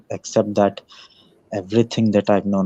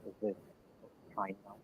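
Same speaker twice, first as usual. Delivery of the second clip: faint, muffled mic; like a weak distant mic; barely audible; 24 dB quieter, much faster than usual. Real, not acted. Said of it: "it's still very low".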